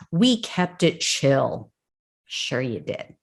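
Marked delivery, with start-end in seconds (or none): 2.3-3.1 dry, low, quiet disbelief; sarcastic. Real, not acted. Host doubting the rioter's claim, quiet sarcastic mutter. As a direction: mutter it, clearly not buying it.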